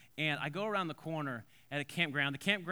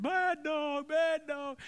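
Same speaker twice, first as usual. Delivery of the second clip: put-on high, loud wailing little boy; hammed-up tearful whine of a small boy; mock crying child; 12 semitones higher, 5 dB louder, much slower than usual. Dragged little boy scolding the dog, mock high bawling. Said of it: "is crying and his big tears".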